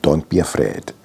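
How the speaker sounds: grandmother's advice. Retold or imitated. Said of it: "my grandmother's advice of".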